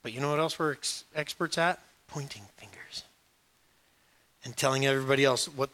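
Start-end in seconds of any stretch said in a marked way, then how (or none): 2.1-3.0 hushed whispered aside. Real, not acted. Preacher whispering an aside to the church. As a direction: drop to a whisper.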